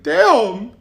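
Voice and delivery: high, loud teasing teacher voice with laughter; hammed-up teacher mocking the new kid. Teacher mocking the student, high and loud.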